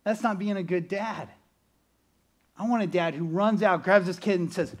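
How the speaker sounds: normal talking voice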